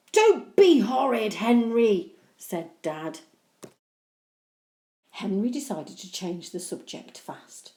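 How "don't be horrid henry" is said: scolding. Acted. Dad scolds.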